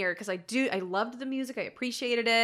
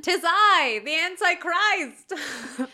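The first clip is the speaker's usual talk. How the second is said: mock Antichrist voice; 8 semitones higher, 7 dB louder, much slower than usual. Mock villain proclamation.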